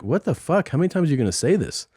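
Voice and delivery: quick, incredulous protest at being nagged; retold exasperation. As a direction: play fed up, pitch lifted. Exasperated at repeated clean-set reminders.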